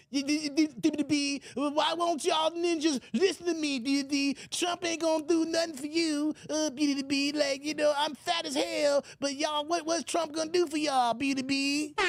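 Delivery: high, stammering mock pundit voice; squeaky, sputtering parody of Roland; Roland Martin impression. Imitating Roland scolding the host, high and sputtering. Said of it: "That's Roland Martin".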